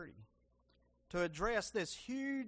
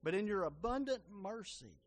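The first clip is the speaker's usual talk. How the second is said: earnest spoken prayer to Jesus. Acted. Preacher leading the church in prayer, earnest.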